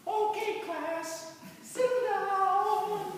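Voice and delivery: put-on high, sing-song old nun voice; lightly voiced elderly teacher settling the class. Old nun telling the class to sit down.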